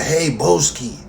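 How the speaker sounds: imitating fans who misname him; mock fan greeting.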